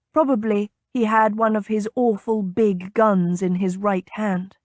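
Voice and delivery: ordinary delivery, nothing marked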